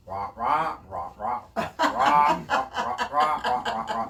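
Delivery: loud, high mock wah-wah gabble, laughing through it; Charlie Brown teacher voice. Imitating a complaining caller as the Charlie Brown teacher, loud high wah-wah. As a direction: squawk nonsense syllables like a cartoon adult. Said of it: "do you remember the voice of the teacher on, uh, Charlie Brown".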